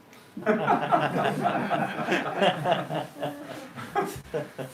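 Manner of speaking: warm full laugh at the punchline. Real, not acted. Monk laughing after the story of death. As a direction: let a real laugh roll out.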